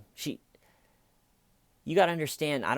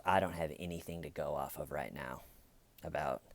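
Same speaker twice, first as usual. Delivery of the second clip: deadpan mock; 6 semitones lower, 9 dB quieter, faster than usual. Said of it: "just goes".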